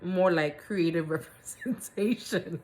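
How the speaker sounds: drawn-out words breaking into laughter; real laugh swallowing the last word; laughing while reading. Real, not acted. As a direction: lose it halfway through the line.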